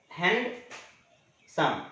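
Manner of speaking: Handsome split in two, hand, long pause, सम; syllable-by-syllable demo. Shown as a demonstration. Teacher shows students how the word breaks in two, syllable by syllable. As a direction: say handsome slowly as two separate beats. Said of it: "दो बार में बोलाता है".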